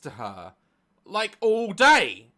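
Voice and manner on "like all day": emphatic. Real, not acted. Exasperated emphasis.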